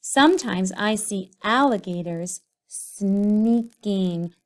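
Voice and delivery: slow, drawn-out reading while writing; slow dictation. Shown as a demonstration. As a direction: dictate each word slowly. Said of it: "say each syllable slowly".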